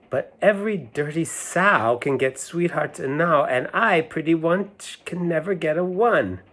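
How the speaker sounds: sing-song nursery rhyme reading; lilting storybook recital. A narrator's reading. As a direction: read it like a rhyme to children. Reads nursery rhyme aloud.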